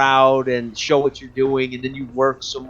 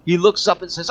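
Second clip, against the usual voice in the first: ordinary talk, nothing marked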